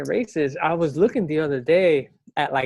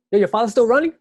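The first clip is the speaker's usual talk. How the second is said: higher, louder mock neighbor's question; eager shouted-out question, imitated; imitated neighborhood question; 8 semitones higher, 7 dB louder, much faster than usual. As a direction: call it out like an old neighbor, pitched up. Imitates neighbors asking about his father.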